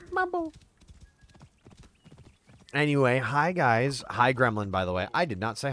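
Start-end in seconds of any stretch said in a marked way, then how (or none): 0.1-0.5 very high-pitched catchphrase mimicry; high squeak. Streamer echoing the Mambo catchphrase, high squeak. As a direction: pop it out tiny and far up high.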